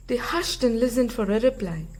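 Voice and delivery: ordinary delivery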